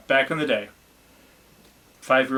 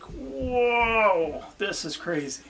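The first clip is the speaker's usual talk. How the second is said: acted amazement; 9 semitones higher, much slower than usual. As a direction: stretch it out in mock astonishment. Acting out amazed reaction to a day.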